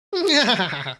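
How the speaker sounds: high, cackling witch laugh. Pretend. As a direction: cackle like an old hag. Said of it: "Can I do a witch laugh?".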